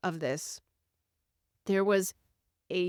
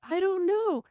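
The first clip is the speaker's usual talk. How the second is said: high, louder imitation of a small child; crying little girl; 12 semitones higher, 5 dB louder than usual. Little girl answers dad's repeated question, imitated high and loud. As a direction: mimic a child's helpless answer, pitched way up. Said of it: "He just keeps asking".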